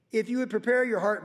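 normal talking voice

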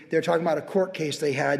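ordinary talk, nothing marked

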